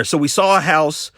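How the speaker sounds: usual speaking voice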